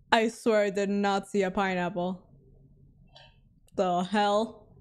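normal talking voice

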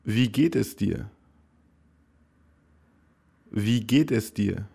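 ordinary delivery

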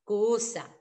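ordinary talk

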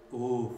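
loud weary uf sigh. A narrator's reading. Reksio giving in to the animals, sighing. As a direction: sigh it out tiredly. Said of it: "westchnął Reksio".

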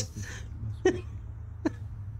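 soft, airless giggle of nerves; breathless nervous laugh. Real, not acted. Viewer laughs nervously after admitting being scared. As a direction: laugh out the fear.

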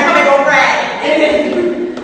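loud, high, drawn-out order; mock mom's holler. Mom ordering her daughter back, hammed-up holler. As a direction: holler it slowly as an insistent mother.

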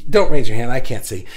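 sudden loud aside. Real, not acted. Joking correction to viewers.

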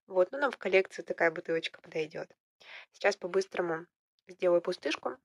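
usual speaking voice

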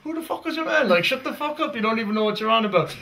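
put-on voice of affronted locals; indignant onlookers. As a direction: act out baffled fans telling someone off. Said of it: "everybody be looking at you like".

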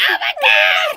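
loud, very high fan scream; ecstatic shriek. Retold or imitated. Fans reacting to a standing ovation, ecstatic shriek. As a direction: cry out loud and high in disbelief. Reaction to idol clapping for them.

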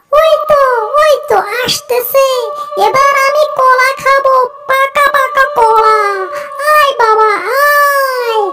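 high-pitched, eager luring voice; greedy banana thief. Acted. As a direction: call the prey over in a high, hungry voice. Hungry thief eyeing the bananas.

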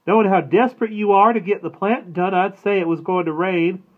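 a playful knowing dig at her husband; Mama teasing with a smile. A narrator's reading. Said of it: "Mama smiled and said".

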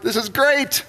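high-pitched acted delight; elated. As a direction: gush with naive triumph. Elijah's elated thought.

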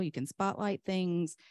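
ordinary talk, nothing marked

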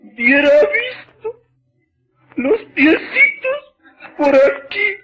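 high, crying wail, hammed up; mock wailing neighbour. Neighbour wails to neighbour about her husband, mock sobbing. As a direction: sob out the tale for laughs.

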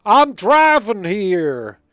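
loud, high hammed-up New Yorker; New York accent. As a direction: bellow it like a New York driver. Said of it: "drive around New York and go".

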